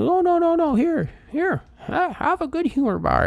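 very high put-on old man voice; high-pitched older gent, waving off the objection; older gentleman. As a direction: imitate an old man insisting on handing out a treat. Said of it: "he was an older gentleman".

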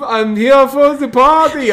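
mock earnest guy. Voicing the lone guy.

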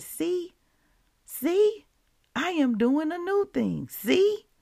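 high, loud insistent repeated "see"; fervent preaching. Acted. Preacher voicing God to listeners, high and urgent. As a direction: urge them to look, rising up. Preaching God's words with fervour.

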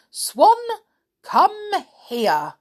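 very high, loud call across the sky; calling the swan. A narrator's reading. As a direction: summon the swan from far off. Witch summons the swan.

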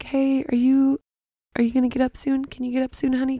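high, softening husband voice; gentle waking. Retold or imitated. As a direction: coax someone awake gently.

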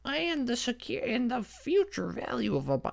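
high, quavery mock old lady voice; old woman lecturing. Mocking the old exam lecturer in a high old-lady voice. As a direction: mimic an elderly lecturer droning on. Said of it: "this fucking eighty-year-old woman lecturing".

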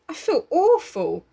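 high, slow and pitiful; whimpering. A narrator's reading. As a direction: moan it weakly and slowly. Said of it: "quavered Piggy".

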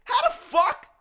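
very high, stretched mock screech; incredulous outburst. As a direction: explode in exaggerated disbelief. Acts out outraged disbelief.

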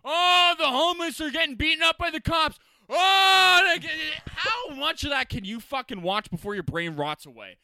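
high, loud doom headlines; ranting shout. Acted. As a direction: yell the bad news like a frantic anchor. Ranting mockery of news headlines.

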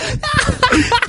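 a burst of high laughter. Real, not acted. High laugh after the punchline.

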